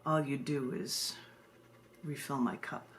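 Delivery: slow, low, solemn poetry reading; poem reading. Acted. Poet reading her justice poem to a live audience, solemn and low. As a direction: read it like a grave spoken-word poem.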